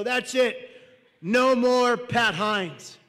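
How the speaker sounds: loud, very slow proclamation; fed-up declaration. Acted. Son ends dad's fight, loud slow declaration. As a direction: lay it down loudly, stretching each word.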